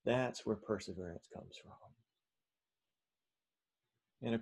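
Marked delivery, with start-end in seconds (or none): 0.1-1.7 much softer, low-key repeat; soft, grave restatement; hushed emphasis. Real, not acted. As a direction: let the point settle quietly. Preacher repeats his point softly for weight.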